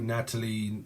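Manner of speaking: ordinary delivery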